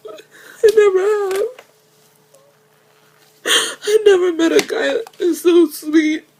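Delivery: high-pitched, real sobbing; crying. Real, not acted. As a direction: break into tears while declaring love. Crying over the man loved.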